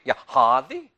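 put-on Massachusetts accent. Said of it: "Y'all have an accent".

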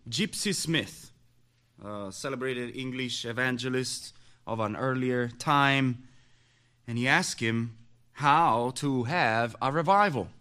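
ordinary talk, nothing marked

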